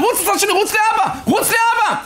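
very high, frantic urging; mother shouting. Retold or imitated. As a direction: drive the child away with a high cry. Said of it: "צועקת לי". (אמא צועקת בבהילות ובקול גבוה)

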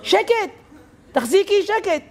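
high-pitched mock yelling; retold shouting. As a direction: cry out over a frantic elevator descent. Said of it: "והיא צועקת".

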